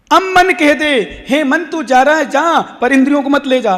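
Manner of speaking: high, commanding address to the mind; emphatic exhortation; preaching. Acted. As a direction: order the wandering mind about, sermon-style. Preaches at full force.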